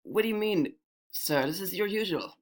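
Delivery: high, soft-voiced protest; puzzled servant answering an angry customer; meek bartender.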